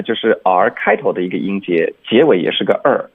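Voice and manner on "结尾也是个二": ordinary delivery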